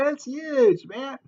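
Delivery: amused. Real, not acted. Laughing at the big fireplace.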